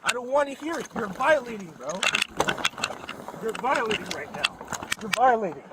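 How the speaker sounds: loud, high-pitched protest; shouting over the officers; yelling. Real, not acted. Driver yelling at officers during arrest.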